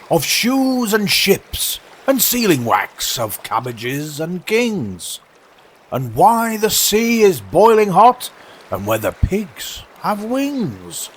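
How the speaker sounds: grand walrus speech. Acted.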